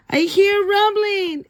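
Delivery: sudden fright at a rumble; alarmed kid. Acted. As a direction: sound the alarm as a startled child. Kids panic at volcano rumbling.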